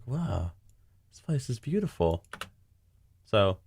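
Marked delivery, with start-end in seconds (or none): 0.0-2.2 soft awed wow, this place is beautiful. Shown as a demonstration. Director demoing the line, soft and awed. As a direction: hush it out in quiet wonder.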